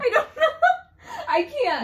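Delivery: high panicked voice; scared squeal. Real, not acted. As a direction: squeak it in fear. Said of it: "I'm so scared".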